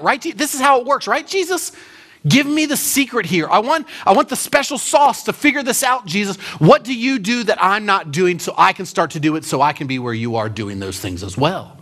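high pleading voice, begging Jesus for the secret; a religious man pressing for the trick; playing eager Nicodemus. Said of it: "He's saying".

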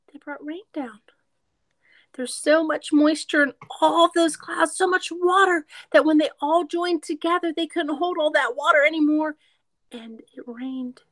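lively storybook read-aloud; reading to children. A narrator's reading. Teacher reading a picture book to kids, lively read-aloud.

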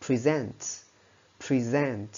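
"present" stressed on the second syllable, pri-ZENT; "present" with the weight on -SENT; verb stress. Shown as a demonstration. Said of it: "với nghĩa là trình bày hoặc giới thiệu".

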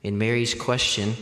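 usual speaking voice

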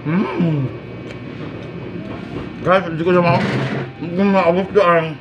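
muffled chewing between words; talking while chewing. Real, not acted. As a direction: mouth partly full. Seen 4: Ate snack just before; closed-lip chewing shapes persist while talking.

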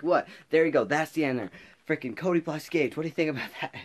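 cocky showing off. Retold or imitated. Student showing off his board drawing, loud and smug. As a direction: brag to the class about the drawing. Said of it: "remember looking back and, like".

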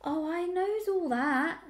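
high, drawn-out rustic ewe; sour. A narrator's reading. Old ewe dismissing the piglet's protest, sourly.

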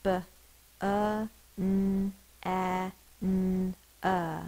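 "B-, uh, n-, a, n-, uh" as separate level sounds; sounded out. Shown as a demonstration. Teacher sounding out banana, "B-, uh, n-, a, n-, uh" one by one. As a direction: say each sound of "B-, uh, n-, a, n-, uh" alone, holding the n. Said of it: "Sound it out".

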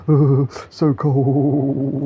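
very slow, drawn-out shivery moan; hammed-up cold victim groaning; mock freezing patient. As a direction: drag it out like a frozen patient. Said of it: "She's like".

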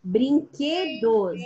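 "Brinquedos" very slow, split as brin-que-dos; syllable demonstration. Shown as a demonstration. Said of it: "qual que é a sílaba tônica".

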